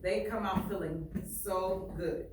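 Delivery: ordinary talk, nothing marked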